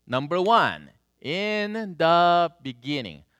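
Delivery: slow, spelled-out reading for a learner; a touch of over-careful slow reading to a foreigner; reading slowly. Retold or imitated. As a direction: read it slow as if slowness makes it clear. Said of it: "just because we read slowly".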